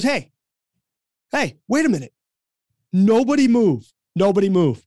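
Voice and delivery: high, forceful imitation of a player halting everyone; keyed-up command. Keyed-up player stopping practice at half court, commanding. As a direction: call a sudden halt, mock-serious. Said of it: "who's a little bit keyed up".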